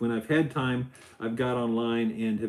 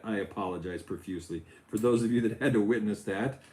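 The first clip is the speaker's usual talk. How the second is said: ordinary talk, nothing marked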